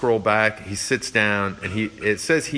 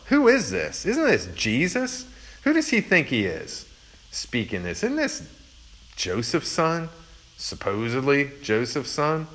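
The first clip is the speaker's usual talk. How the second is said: much higher, scoffing disbelief; incredulous crowd; 5 semitones higher than usual. Retold or imitated. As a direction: play the doubting neighbours, baffled and dismissive. Townspeople doubting Jesus.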